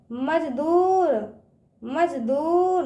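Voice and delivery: slow मजदूर, दू held long; drawn-out long ū. Shown as a demonstration. Teacher drilling children, मजदूर with drawn-out ū. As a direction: stretch the ū in मजदूर. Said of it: "इसका उच्चारण ना खींच के करते हैं".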